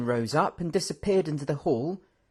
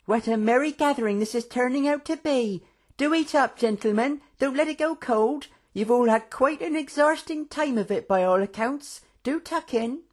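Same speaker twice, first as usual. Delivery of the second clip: cheerful hostess; 12 semitones higher than usual. A narrator's reading. Karina voiced, hostess urging.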